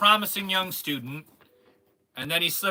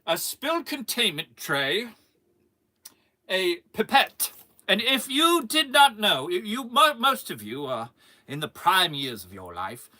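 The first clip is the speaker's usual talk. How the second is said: pompous mock British lecture; put-on British, emphatic and showy; British accent; 5 dB louder than usual. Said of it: "I'll, I'll do a British accent".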